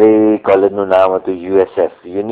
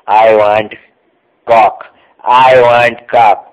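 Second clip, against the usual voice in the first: loud, slow drawn-out shout into the machine; shouting for coke; 4 dB louder, much slower than usual. Retold or imitated. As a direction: yell the order down at the vending slot. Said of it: "બૂમો પાડે છે". (જોરથી ધીમી બૂમ)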